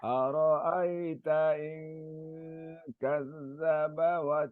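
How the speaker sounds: slow melodic Quranic chanting; Quran recitation. Acted. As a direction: recite slowly in tajweed melody. Student recites Quran verses.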